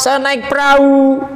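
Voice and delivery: high, loud acted reply; soul answering. Soul replies to the angel, high loud acted voice. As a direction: cry the answer out.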